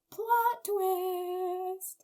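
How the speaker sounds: high, drawn-out teasing announcement; mock dramatic reveal. Recapper revealing the twist, mock dramatic. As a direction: stretch it out in a high voice.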